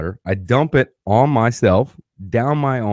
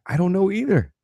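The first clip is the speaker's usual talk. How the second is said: high baffled shrug; 11 semitones higher than usual. Retold or imitated. Baffled reply to passengers.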